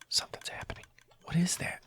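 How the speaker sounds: very soft, suspenseful whisper; hushed suspense. Real, not acted. Player noticing something happening in his machine, hushed suspense. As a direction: whisper it as if not to disturb it.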